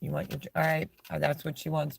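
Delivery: ordinary talk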